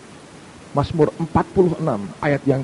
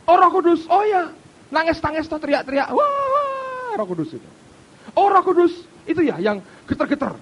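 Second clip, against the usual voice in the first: much higher, louder scoffing mock voice; mocking imitation; 13 semitones higher, 4 dB louder than usual. Preacher mocks people who scoff at the Holy Spirit, hammed-up scorn. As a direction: ham up a sneering skeptic.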